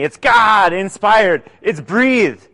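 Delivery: ordinary talk, nothing marked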